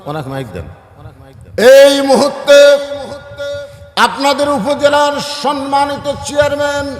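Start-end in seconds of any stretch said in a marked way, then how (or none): 1.6-7.0 loud, high, stretched-out announcer's call; formal announcement. Real, not acted. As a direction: proclaim the honoured guest to the whole crowd. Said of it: "আমি ঘোষণা করতেছি". (উঁচু গলায় টেনে টেনে আনুষ্ঠানিক ঘোষণা)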